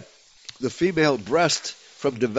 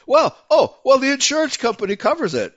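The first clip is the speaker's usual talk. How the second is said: high-pitched, hurried mock parent; put-on high voice, dismissing all concern; mock complacent parent; 9 semitones higher, faster than usual. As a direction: mimic an unthinking parent, pitched way up. Voicing parents who allow it.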